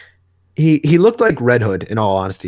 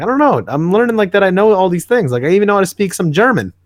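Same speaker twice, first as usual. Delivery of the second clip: baffled Rick; 7 semitones higher, faster than usual. Retold or imitated. Rick amazed at his own knowledge.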